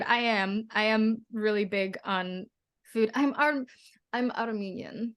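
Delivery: bad, lightly put-on Armenian accent; weak Armenian accent that drifts Russian. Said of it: "I'm really doing a bad job of the accent".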